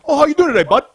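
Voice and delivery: high, loud friendly gym guy; mock-friendly stranger voice; chummy greeting. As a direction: put on a cheery small-talk voice. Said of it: "Like, like".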